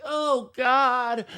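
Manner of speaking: high-pitched hammed-up dismay; mock disgust. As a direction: recoil from a bad smell in a high voice. Acts rider hit by fart smell.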